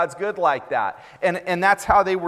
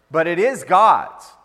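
slow, louder punch on God's; emphatic; 7 dB louder, slower than usual. Acted. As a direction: lean hard into God's, slow and firm. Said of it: "preacher emphatic point".

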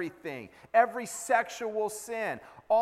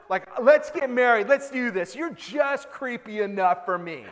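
hammed-up sarcastic swooning over a creep; mock smitten; 3 semitones higher, 6 dB louder than usual. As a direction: play a besotted girl, tongue in cheek. Sarcastic girl falling for creep.

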